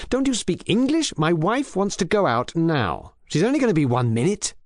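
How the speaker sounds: high-pitched, hurried, frustrated shout; impatient shout. Acted. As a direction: snap at the attendant, running out of time. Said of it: "he shouts".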